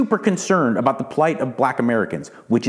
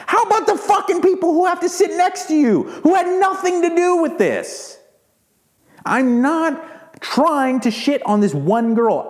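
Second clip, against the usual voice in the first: much higher, pitched-up indignant rant; exasperated rant. Real, not acted. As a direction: let the outrage push the voice up. Angry rant at passenger.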